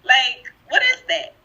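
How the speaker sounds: high-pitched question through laughter; amused, squeaky bafflement; laughing disbelief. Real, not acted. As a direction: ask it incredulously while cracking up. Laughing at the imitated voice switch.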